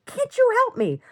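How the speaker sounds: very high, loud mock complaint; pissed-off imitation. Partner snapping at partner over the dog's mess, mock pissed-off. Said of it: "he gets pissed off".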